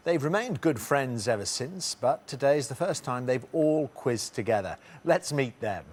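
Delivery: normal talking voice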